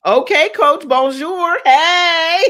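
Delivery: loud, high-pitched cheer bursting into laughter; excited whoop. Real, not acted. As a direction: call it out in giddy celebration. Celebrating the Paris trip aloud.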